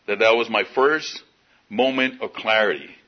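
ordinary delivery

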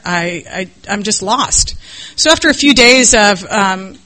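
ordinary talk, nothing marked

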